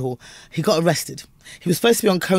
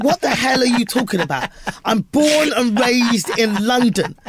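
higher-pitched comic outrage; mock indignation; 7 semitones higher than usual. Comedian answering the critic who reviewed him, indignant. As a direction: protest incredulously, stretching key words.

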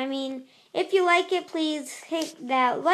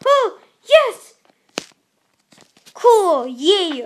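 high, loud excited cheer; loud victory cheer after a jump; 3 semitones higher, 9 dB louder, slower than usual. Real, not acted. Wins a jump.

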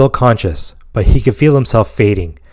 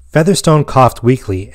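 plain reading voice

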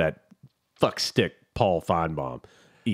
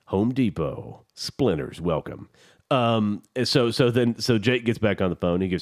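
ordinary delivery

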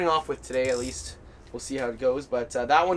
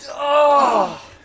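excited shout; 9 semitones higher, 7 dB louder than usual. Real, not acted. Fish lost at the hole.

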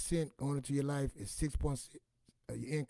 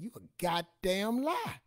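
louder, higher, slow indignant snap; grandma cursing; 7 semitones higher, 6 dB louder, slower than usual. Retold or imitated. Grandmother snapping at grandson on the phone. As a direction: bite out every word in outrage. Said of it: "she said".

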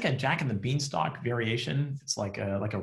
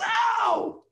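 very high pained shout; old man hit a second time, squealing in mock pain; yelled ow; 4 dB louder than usual. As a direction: shriek it way up high as the log hits again. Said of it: "He yells".

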